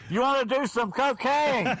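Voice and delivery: high, slow mock shout over party noise; retold yell. Nervous guy yelling to be heard at a loud party, mock yell. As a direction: yell it like someone shouting into an ear. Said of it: "he goes, and he yelled".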